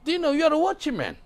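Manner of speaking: high, loud fervent preaching; preaching at full force. Acted. Preacher thundering at the congregation, high and loud. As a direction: drive it home to the congregation.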